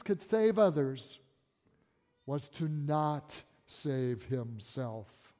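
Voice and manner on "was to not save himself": very slow, weighing each word; slow emphasis. Acted. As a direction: spell it out slowly so it sinks in. Preacher drives home key point.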